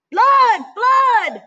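a cat trying to scare the birds off; crying out. Acted. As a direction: yell the false alarm. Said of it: "he cried".

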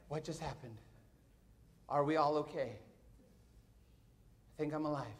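low, soft dazed voice; stunned, hushed. Retold or imitated. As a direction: imitate checking in after a shock. Stunned people after the fire.

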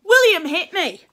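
put-on high, loud child's complaint; whining little boy. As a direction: tell tales on big brother. Child telling tales to mother.

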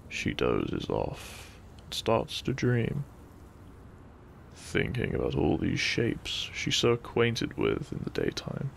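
usual speaking voice

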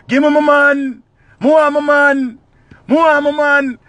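high, loud wailing woman; high loud plea for her man; Daima's wail. Acted. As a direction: bawl it out desperate for her man.

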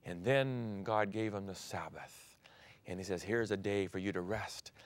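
soft whisper of a sacred gift; hushed. Acted. Preacher whispering God's Sabbath promise to Adam. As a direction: whisper it like a secret blessing.

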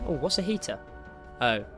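ordinary talk

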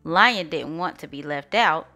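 plain reading voice

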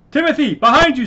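high, loud cry of alarm; warning shout. Acted. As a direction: shout the warning at full pitch.

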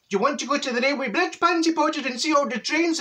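put-on high coaxing voice for a toddler; sing-song baby talk. Auntie coaxing the toddler toward the trains, high sing-song. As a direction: ask it up high like talking to a wee one.

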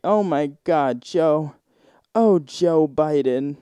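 a light, hammed-up moan as the wife; imitating wife moaning. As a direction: play the wife calling out his name in bed. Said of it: "That's what Joe Biden's wife's saying".

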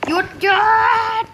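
high, loud yelling stop; frantic shout. Real, not acted. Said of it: "เริ่มจะหัวร้อนกับเน็ตแล้วนะ". (ตะโกนสั่งหยุดเสียงสูง)

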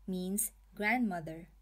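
normal talking voice

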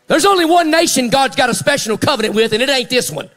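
high preacher's cry building to a shout; forceful preaching. Real, not acted. Preacher declares Israel's covenant to the church, forceful preaching.

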